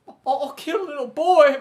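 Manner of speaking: high, loud wailing mock cry; hammed-up crying quote; mock sobbing Ray. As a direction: wail it like a sobbing hitman. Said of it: "he's like".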